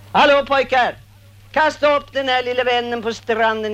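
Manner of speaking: loud, high call across the water. Acted. Old woman calling her helpers to the shore, loud call. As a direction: hail them from afar.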